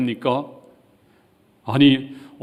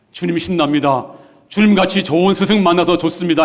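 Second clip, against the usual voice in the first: lightly hammed-up excitement, rising higher and louder; mock delight; 6 semitones higher, 5 dB louder than usual. Preacher imagining Peter's wrong confession to the Lord, mock delight. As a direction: gush to a teacher, half in parody.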